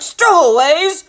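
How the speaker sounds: high, loud outraged captain; thundering sea-captain rage; roaring fury. A narrator's reading. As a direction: explode into blustering threats. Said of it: "he roared".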